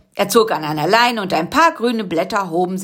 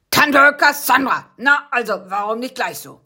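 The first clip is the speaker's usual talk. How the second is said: satisfied guard; 5 semitones higher than usual. Acted. Guard accepts the password.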